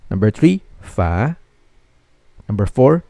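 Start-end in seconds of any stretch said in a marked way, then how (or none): 0.8-1.4 "fa" starting low and rising; second tone. Shown as a demonstration. Teacher drilling learners in second tone, "fa" rising. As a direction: glide "fa" upward from low. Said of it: "One more time".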